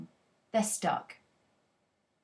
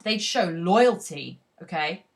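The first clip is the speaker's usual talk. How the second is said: usual speaking voice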